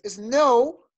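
"snow" drawn out with a rising tune; stretched, rising "snow" for the class to copy; pronunciation model. Shown as a demonstration. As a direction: model "snow" carefully for learners. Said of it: "Las voy a pronunciar yo".

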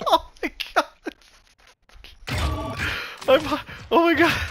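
breathless hyperventilating after laughing. Real, not acted. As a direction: gasp it out, hyperventilating. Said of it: "I'm hyperventilating".

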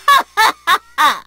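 a big, bold womanly laugh that falls away; open-throated amused laugh; hearty laugh. Acted. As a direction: laugh like a proud matron warrior. Character laugh in voice set.

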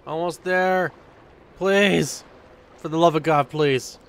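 loud desperate plea; loud please-hurry begging. Real, not acted. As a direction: beg the game, loudly. Begging game to finish.

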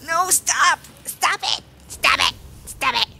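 panicked cries, easing off from high and loud; urgent, scared bursts; frightened pleading. Real, not acted. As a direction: beg the attacker off. Pleading with the attacker in game.

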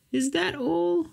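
put-on very high, flat girlish voice; bored girl voice. A daughter brushes off her father, high and unimpressed. Elizabeth's reply in cartoon.

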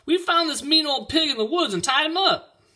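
put-on loud, high-pitched kid's voice; very high boyish holler; boys shouting. A narrator's reading. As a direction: shout the news like an excited boy. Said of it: "the boys shouted".

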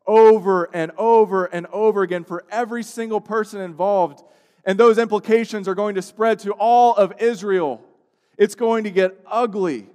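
loud, rising sermon peak; full-voiced preacher building to a cry; preaching at full force. Real, not acted. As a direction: drive it home from the pulpit.

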